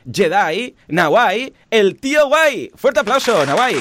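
big slow showman intro; announcer voice. Acted. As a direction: announce the guest like a host. Announcing the guest host.

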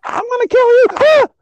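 high, loud, shrieking threat; hammed-up high-pitched battle cry; Prepotente impression. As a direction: scream it like a manic goat-man. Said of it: "I'd probably talk like Prepotente".

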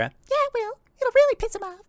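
very high, squeaky sidekick voice; high-pitched yes-man agreeing; second bar patron. Acted. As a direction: echo the boast in a tiny falsetto. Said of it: "And then you hear a".

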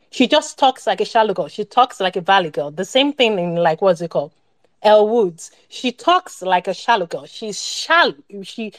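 usual speaking voice

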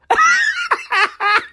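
squealing, breathless laughter; high-pitched giggle fit. Real, not acted. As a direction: lose it completely. Cracks up again.

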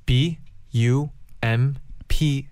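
letter by letter, very slowly; spelling it out. Shown as a demonstration. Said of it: "그다음에 bumps".

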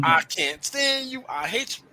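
high, drawn-out mock outburst; acted lovers' quarrel. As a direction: play up a couple's spat, slow and loud. Acts out couple's fight behind collage.

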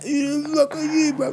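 high, slow sneering challenge; mock drunk challenge. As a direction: drag it out like a cocky drunk. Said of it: "You like".

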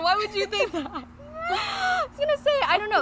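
laughing, high and loud; embarrassed giggling protest; flustered laughing. Real, not acted.

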